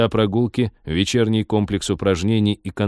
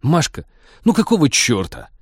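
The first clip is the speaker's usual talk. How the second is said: raised, exasperated protest; 6 semitones higher, 3 dB louder than usual. Acted. Captain scolds the computer for spoiling his book, exasperated. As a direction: protest sharply, pitched up.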